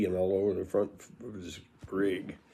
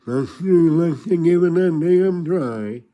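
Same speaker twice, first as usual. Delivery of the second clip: slow, slightly put-on hare-lip voice; imitating Lane; 6 semitones higher, 6 dB louder, slower than usual. Lane answering the hunting invite, slow imitated voice. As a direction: drag it out as hare-lipped Lane. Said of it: "he had a hair lip".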